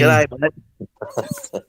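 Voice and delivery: light playful Aussie greeting; Aussie accent. Pretend. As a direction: toss off an easy Australian hello. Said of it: "full on Aussie".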